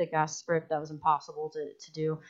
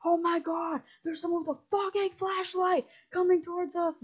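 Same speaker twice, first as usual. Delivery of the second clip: high, panicked yelping, much higher pitch; panicked mock scream; 12 semitones higher than usual. Friend spotting a flashlight in the woods, panicked high scream. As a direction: squeal it like you just saw a stranger's flashlight. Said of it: "like, screaming".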